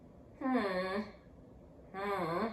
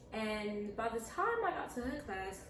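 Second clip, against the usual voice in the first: usual speaking voice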